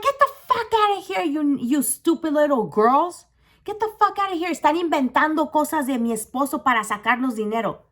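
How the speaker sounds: high, dismissive scolding; angry wife. Retold or imitated. Wife to the girls accusing her husband, angry dismissal. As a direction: imitate a wife throwing girls out. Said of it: "You know what the bitch said?".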